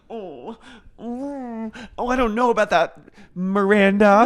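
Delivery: high, fast, flustered fluttering voice; pearl-clutching hesitation; prim Charlotte. Retold or imitated. Charlotte backing away from Miranda's drinking, high flustered voice. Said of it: "she goes like this".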